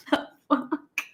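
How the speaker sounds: hushed, incredulous gasp of a line; stunned disbelief. Retold or imitated. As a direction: breathe it out softly, dumbfounded. Stunned reaction to learner's arrogance.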